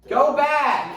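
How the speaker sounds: loud high call; shouting. Real, not acted. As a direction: yell it across the car. Calling out to driver.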